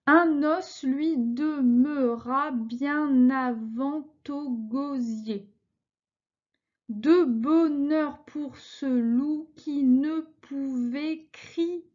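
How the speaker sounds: slow, syllable by syllable verse reading; scanning syllables slowly. Shown as a demonstration. Teacher scanning a verse for her class, slow and measured. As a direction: count out each syllable of the line as you read. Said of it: "je vous ai découpé le vers".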